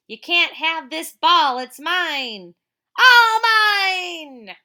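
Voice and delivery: loud, high, slow taunting voice; hammed-up bully hogging the swing, loud and high; greedy bully. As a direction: gloat like a playground bully. Bully in the comic.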